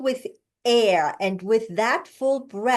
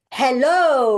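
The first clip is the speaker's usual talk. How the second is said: louder, slow "hello" on a full breath; grounded hello; 3 semitones higher, 5 dB louder than usual. Shown as a demonstration. Coach demonstrating a more natural hello. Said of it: "Say that hello more normally".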